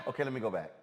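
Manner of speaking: normal talking voice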